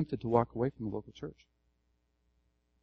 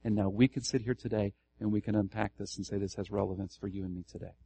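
normal talking voice

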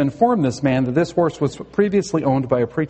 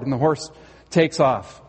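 normal talking voice

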